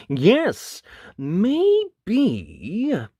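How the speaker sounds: bashful wolf. Acted. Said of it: "he said".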